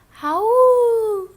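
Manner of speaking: loud, high, sustained howl; wolf howl. Shown as a demonstration. Teacher demonstrating animal sounds, long loud wolf howl. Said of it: "Animal sounds".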